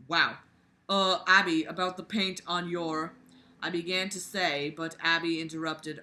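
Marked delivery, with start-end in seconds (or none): none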